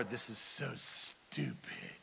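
unvoiced, low, slow grumble under the breath; whispered grumble. Naaman complaining mid-dip, a whispered grumble. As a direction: grouse to yourself in a whisper. Naaman muttering while dipping.

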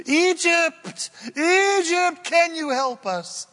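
hammed-up cry for help to an ally; mock desperate plea. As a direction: Hezekiah begging Egypt, comic high pleading voice. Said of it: "he pulled the classic old card".

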